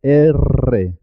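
strongly trilled R in "R"; rolled double R. Shown as a demonstration. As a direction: say "R" as erre with a long vibrating tongue-tip trill. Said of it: "se pronuncia doble".

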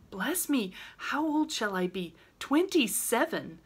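mock dismay at growing old; lightly exclaimed surprise; exclaiming. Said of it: "exclaimed Meg".

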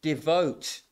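devote set apart and stressed after a pause; devote, given weight after a pause; key word stressed. Shown as a demonstration. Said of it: "the key word is the word".

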